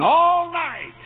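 high, loud, long-held words; fervent preaching shout. Real, not acted. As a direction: stretch out the whole night in a cry. Preaching climax of Jacob's struggle.

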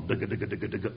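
soft, low mimicked machine chatter; a quiet mechanical dugga-dugga rhythm; teletype clatter. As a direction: tap it out like an old teletype.

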